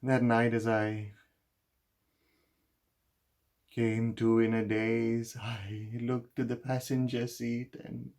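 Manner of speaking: loud, high-pitched outpouring of guilt; anguished confession. Acted. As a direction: pour out the guilt at full emotional pitch. Guilt-ridden confession at its emotional peak.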